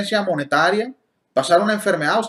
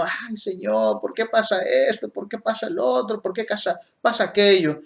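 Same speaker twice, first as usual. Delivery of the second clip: higher-pitched imitation of complaining to God; mock complaining; 4 semitones higher than usual. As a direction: whine lightly, question after question, imitating believers. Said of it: "nos la pasamos quejándonos". (queja fingida, en tono más agudo)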